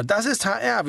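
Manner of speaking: narrator's restrained shout; mild repeated shout. A narrator's reading. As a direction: drive it home over the music. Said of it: "wiederholte sie".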